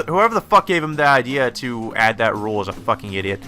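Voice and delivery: ordinary talk, nothing marked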